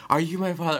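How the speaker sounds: high, overdone soap opera plea; hammed-up melodramatic question; pleading TV drama line. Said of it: "every time it's like".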